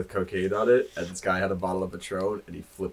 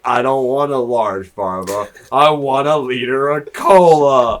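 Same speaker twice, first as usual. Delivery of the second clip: a slow, louder hint of angry Farva; 5 semitones higher, 11 dB louder, slower than usual. Imagining the drunk cop as Farva, slow and louder. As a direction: bark it slowly like a sulky cop ordering. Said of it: "I imagine him being like".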